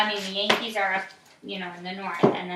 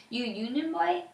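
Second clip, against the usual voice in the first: higher, mocking question; jeering; 7 semitones higher than usual. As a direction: taunt the boy. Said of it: "One jeered".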